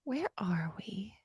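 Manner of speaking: quiet unvoiced self-question; hushed and slow; whisper. Real, not acted. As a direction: whisper it while regrouping. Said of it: "Take a deep breath down".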